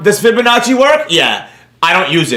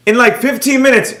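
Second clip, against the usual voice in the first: ordinary talk, nothing marked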